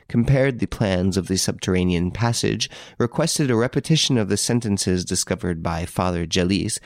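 ordinary delivery

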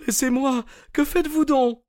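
high-pitched moaning woman's voice; high moan of a woman caught out; whining woman. A narrator's reading. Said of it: "gémit-elle".

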